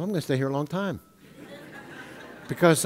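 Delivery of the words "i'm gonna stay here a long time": teasing thought. Retold or imitated. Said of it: "I'm thinking to myself".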